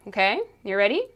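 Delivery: ordinary talk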